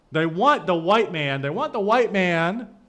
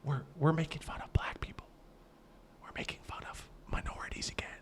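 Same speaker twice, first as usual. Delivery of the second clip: hushed mock scandal whisper; 14 dB quieter, faster than usual. Streamer mocking the audience's shock, in a whisper.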